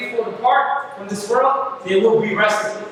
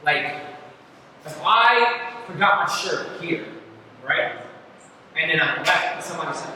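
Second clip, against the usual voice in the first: usual speaking voice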